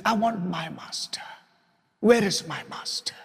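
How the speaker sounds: hushed, breathy plea for the master; whispered longing. Retold or imitated. Preacher voicing weeping Mary searching for Jesus, whispered. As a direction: whisper it like a grieving woman searching.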